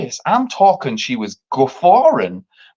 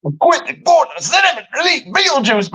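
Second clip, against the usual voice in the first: indignant; 12 semitones higher than usual. Real, not acted. Outraged at the cost.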